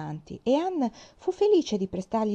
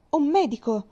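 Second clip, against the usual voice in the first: higher, louder call for help; urgent call; 7 semitones higher, 5 dB louder than usual. A narrator's reading. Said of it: "disse Anne".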